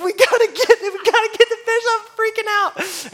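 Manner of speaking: high, frantic panic; frantic high-pitched panic; freaking out. Retold or imitated. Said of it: "And I'm like".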